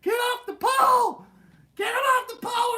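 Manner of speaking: high-pitched full shout; screaming mom. Retold or imitated. Mom yelling at kid clinging to pole, screaming. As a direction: imitate a furious mom yelling at top volume. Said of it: "My mom is screaming at me".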